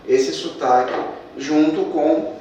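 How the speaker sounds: ordinary talk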